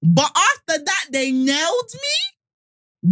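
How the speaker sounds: high, very drawn-out comic indignation; mock outraged disbelief. Jesus confronts God about being nailed, hammed-up disbelief. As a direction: stretch it out in appalled mock protest.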